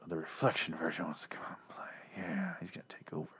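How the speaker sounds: near-whispered, trailing commentary; hushed aside. Real, not acted. Podcaster making an aside about a Goosebumps plot, hushed. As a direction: drop almost to a whisper.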